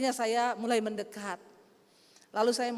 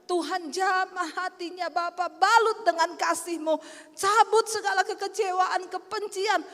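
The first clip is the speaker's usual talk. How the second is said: high, pleading intercession; re-enacted prayer, raised and insistent; fervent prayer; 6 semitones higher, 3 dB louder than usual. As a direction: pray urgently over someone in tears. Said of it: "Saya doakan".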